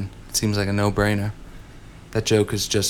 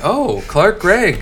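high slow gushing gasp; mock starstruck; 9 semitones higher, 4 dB louder, much slower than usual. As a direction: swoon over a minor star. Mock excited fan reaction.

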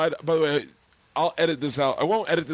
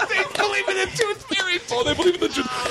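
ordinary talk

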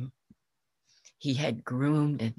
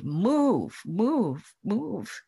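angry commands; 6 semitones higher, much slower than usual. Acted. Farmer beating his ox, yelling at it to move.

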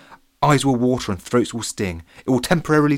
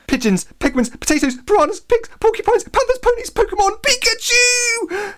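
about to explode with excitement, ever higher and louder; overexcited; 6 dB louder than usual. A narrator's reading. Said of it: "said an overexcited Harry Basher".

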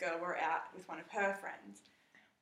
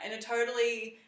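ordinary delivery

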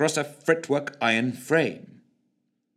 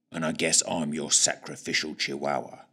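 low, soft words through clenched jaw; gritted teeth; 9 semitones lower, 5 dB quieter than usual. Acted. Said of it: "through gritted teeth".

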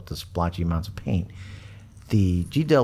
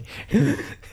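high giggle. Real, not acted. Laughing at the joke.

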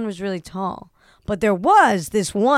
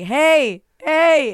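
calling out; 7 semitones higher, 7 dB louder than usual. Retold or imitated. Calling out to tall man.

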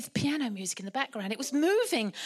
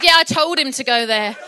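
smug Joseph; 12 dB louder than usual. Retold or imitated. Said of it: "Joseph said".

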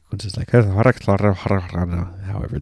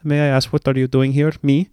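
emotionless Nordic character; 6 semitones higher than usual. Retold or imitated. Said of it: "there's just no emotions".

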